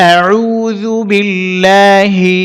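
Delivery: slow, drawn-out Quranic recitation. Shown as a demonstration. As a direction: recite it slowly, stretching each sound. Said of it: "আবারও বলছি". (ধীরে তিলাওয়াত)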